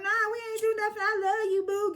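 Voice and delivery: high, put-on cooing voice; smooth it over in a high sugary voice; mock sweet-talk. Heavenly making up with Sweet Tea, high mock sweet-talk.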